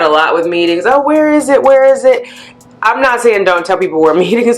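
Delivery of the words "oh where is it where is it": high, pestering imitation of clueless coworkers; mock pestering. Mimics people pestering about meetings.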